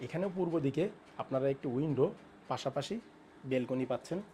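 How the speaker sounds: hushed tour narration; speaking softly. Real, not acted. Host showing balcony near sleeping baby, speaking softly. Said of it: "আস্তে আস্তে কথা বলছি".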